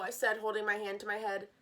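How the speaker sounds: plain reading voice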